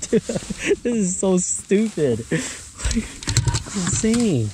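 high, slow, laugh-filled voice; giddy disbelief. Real, not acted. Angler calling the catch stupid, giddy with laughter. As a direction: talk through helpless laughter. Giddy over another giant.

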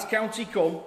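high, loud Yorkshire declaiming; Yorkshire accent. Acted. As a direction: ring it out in broad Yorkshire. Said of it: "In a Yorkshire accent".

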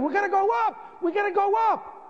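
high urgent shout. Retold or imitated. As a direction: yell it up the stairwell. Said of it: "And I start screaming".

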